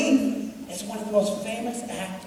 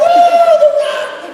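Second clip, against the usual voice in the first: much higher, louder squeal; freaking out; 12 dB louder, much slower than usual. Retold or imitated. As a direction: shriek with star-struck excitement. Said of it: "freaking out on the phone".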